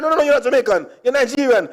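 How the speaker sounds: high, insistent denial; insistent man. Retold or imitated. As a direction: deny it firmly.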